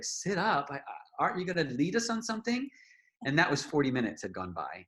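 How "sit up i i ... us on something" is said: high, fast disbelieving question; incredulous. Retold or imitated. Told to sit up, high fast disbelieving protest. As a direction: blurt it out in confusion. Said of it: "And I was just like".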